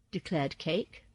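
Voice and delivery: ordinary delivery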